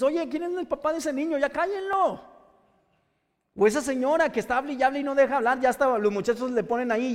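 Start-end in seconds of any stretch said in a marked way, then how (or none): 0.0-2.2 high, exasperated complaint; irritated call. Retold or imitated. Annoyed adult complaining about a screaming child, exasperated. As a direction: snap at the noisy kid's parents. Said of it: "tú le dices".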